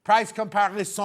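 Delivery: lightly put-on French accent. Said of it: "do that in a French accent".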